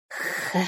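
soft dragon huff; thoughtful puff. A narrator's reading. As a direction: exhale a short, quiet voiceless puff. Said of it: "задумчиво пыхнула Кира".

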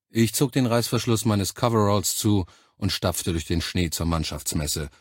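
ordinary delivery, nothing marked

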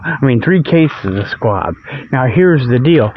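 ordinary delivery

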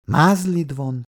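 ordinary delivery, nothing marked

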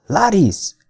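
high, falling call of a name; repeated call. A narrator's reading. Man calling again for the vanished woman, calling out. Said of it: "ismételtem".

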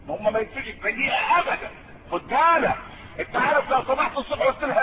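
ordinary talk, nothing marked

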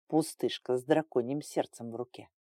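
low, soft murmur; quiet. A narrator's reading. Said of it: "тихо проговорил Эйнар". (тихо, низким голосом)

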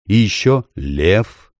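very slow, deep and calm. Acted. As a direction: repeat it slowly and deeply. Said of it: "повторил голос".

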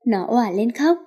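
plain reading voice

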